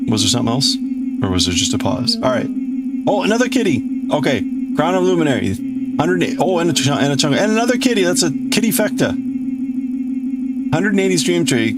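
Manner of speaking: normal talking voice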